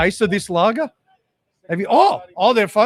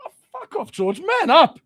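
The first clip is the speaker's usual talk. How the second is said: mates jeering at George; 11 semitones higher, 4 dB louder, slower than usual. Retold or imitated. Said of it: "And we go".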